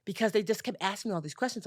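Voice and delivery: ordinary talk, nothing marked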